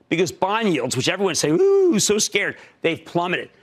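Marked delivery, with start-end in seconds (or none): 1.6-2.6 high, louder mock fright; a hammed-up whimper of fake fear; mock scared. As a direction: sneer at the scaredy-cats. Mocking the nervous crowd.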